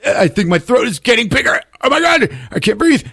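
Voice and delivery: hammed-up can't-breathe panic; panicking teen. Peter panicking, voiced.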